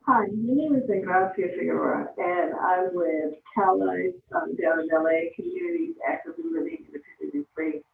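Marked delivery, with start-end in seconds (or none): 5.4-7.8 rattled off quickly; rapid, run-together introduction; too fast. Real, not acted. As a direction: hurry through the organization's name. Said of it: "can you slow down a little bit".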